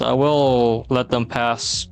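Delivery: ordinary talk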